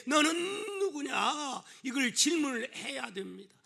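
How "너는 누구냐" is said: high, slow, drawn-out challenge; challenging question. Acted. Preacher voicing the question to ask when upset, challenging question. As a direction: stretch the question out insistently.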